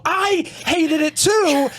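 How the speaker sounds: light up and stretch every word; eager agreement. Retold or imitated. He mimics his eager reply to the taxi driver, high and slow. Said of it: "you just see the energy in me go".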